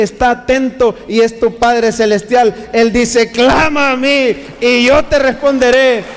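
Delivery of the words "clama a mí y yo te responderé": louder, higher fervent sermon climax; impassioned preaching. Acted. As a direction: preach it with building fervor. (predicación ferviente)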